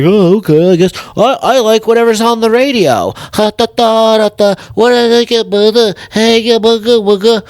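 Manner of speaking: high, dopey NPC voice; mock NPC. Comic mocking bland radio listeners, dopey NPC voice.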